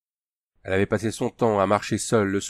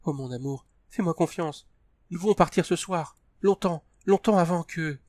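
urgent plea; 7 semitones higher than usual. A narrator's reading. Tania pleading urgently.

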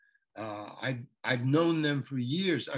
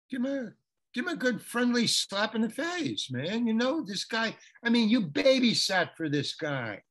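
excited director; 8 semitones higher, 3 dB louder than usual. Retold or imitated. Vince giving excited direction.

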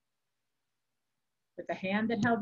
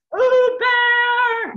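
huge, high, drawn-out shout; bellow; 14 dB louder than usual. A narrator's reading. Furious monk bursts in on the abbot, bellowing. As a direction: roar the name across the room. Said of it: "Michelangelo bellowed".

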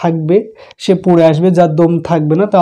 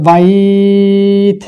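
slow chant holding three alifs; drawn recitation; much slower than usual. Shown as a demonstration. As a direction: stretch বাইত long, then stop. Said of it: "তিন আলিফ টেনে থামবো". (তিন আলিফ টেনে তিলাওয়াত)

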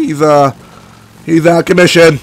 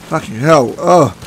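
normal talking voice

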